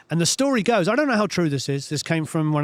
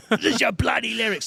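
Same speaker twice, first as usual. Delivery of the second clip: angry outburst; 7 semitones higher than usual. Retold or imitated. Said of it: "throws the lyrics at him".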